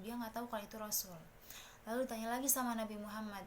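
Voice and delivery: usual speaking voice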